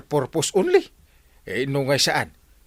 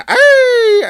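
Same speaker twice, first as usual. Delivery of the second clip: much higher, louder ay; exasperated cry; 9 dB louder than usual. Acted. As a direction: throw up your hands with a cry. Captain exclaims in exasperation.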